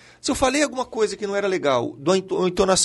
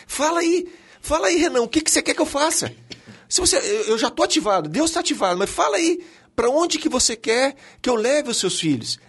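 lightly acted God, pitched higher; God urging a parent; 9 semitones higher than usual. God in heaven asking a parent for instructions, lightly acted urging. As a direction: raise the pitch and press for an answer. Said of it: "e Deus falava, Deus tá lá no céu assim".

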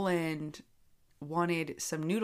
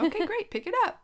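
mock-sweet triumph; 13 semitones higher, 5 dB louder than usual. Mom wins the standoff.

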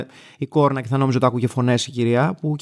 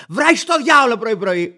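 mock curse; 6 semitones higher than usual. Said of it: "Και μου κάνει".

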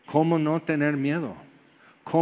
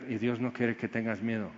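soft, lower, gentle refrain; tender assurance; 3 semitones lower, 7 dB quieter than usual. Real, not acted. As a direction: speak it quietly as comfort. (suave y tierno)

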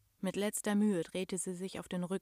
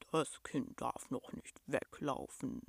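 low, slow, soft beast voice; rumbling monster; 6 semitones lower, 6 dB quieter, slower than usual. A narrator's reading. Monster gently holding the child back, deep slow rumble. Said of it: "brummte das Ungetüm".

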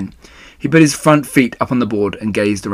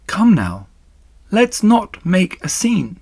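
slow, higher-pitched soothing; composed spider; 9 semitones higher, slower than usual. Acted. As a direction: settle a distraught friend, slowly. Spider hushing the sobbing pig.

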